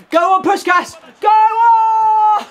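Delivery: very high, loud cheering; top-of-the-voice yell; urging shout. Real, not acted. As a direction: roar him on toward goal. Urging a shot.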